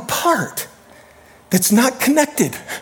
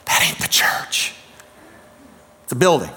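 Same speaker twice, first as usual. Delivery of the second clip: whispered aside; 8 semitones lower, 5 dB quieter than usual. Real, not acted. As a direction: drop to a whisper to make the point. Pointed aside to the congregation.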